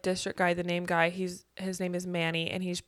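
ordinary delivery

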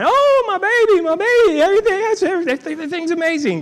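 high, tearful mother's cry of relief; mom crying with joy. Retold or imitated. Mother sees her paralyzed son walk, high tearful joy. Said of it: "my mom was starting to cry".

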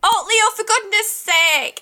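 high, drawn-out exasperation; slow, high-pitched reproach; exasperated scolding. Acted. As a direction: scold an unruly boy, at wit's end. Scolding the struggling captive.

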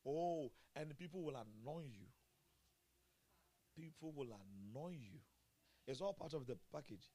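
ordinary delivery

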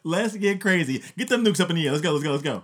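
hyped-up, rowdy and loud; rowdy party animal. Pretend. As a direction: whip up a wild crowd. Mock Kim hyping nukes.

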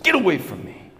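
high-pitched acted rebuff; sharp rejection. Cold wife pushing her pleading husband away, rejecting. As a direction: push the person away with the voice.

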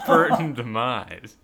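giggling while reading; words squeezed out through laughter; laughing through it. Real, not acted. Reader cracking up at her own typo.

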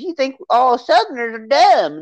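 a hint of a Southern accent. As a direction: imitate a touchy Southern guy. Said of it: "he talks with a Southern accent".

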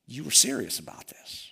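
breathless whispered realisation; hushed aghast whisper; stunned whisper. Retold or imitated. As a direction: whisper it, staring at the ruins. Jeremiah stunned by the destruction.

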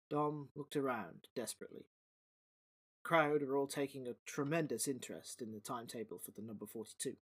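low, soft storyteller read; story narration. A narrator's reading. Narrator reading the story to podcast listeners, low and soft. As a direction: settle in and tell the tale quietly.

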